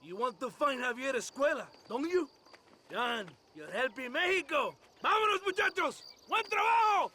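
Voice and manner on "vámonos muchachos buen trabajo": cheerful, much higher shout to the men; rallying shout. Acted. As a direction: call the boys off with gusto. Colonel rallying his men.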